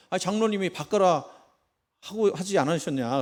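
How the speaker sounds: 답답해서 따지듯; exasperated protest. Acted. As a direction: push back at the elder in disbelief. Frustrated pastor protesting to elder.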